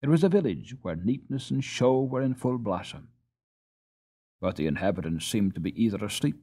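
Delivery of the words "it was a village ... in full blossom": plain reading voice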